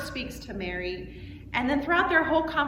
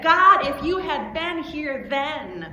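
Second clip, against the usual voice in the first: loud, slow, higher outcry; raised voice; 4 semitones higher, 7 dB louder, slower than usual. Acted. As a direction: call God out in angry reproach. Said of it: "raised your voice and said".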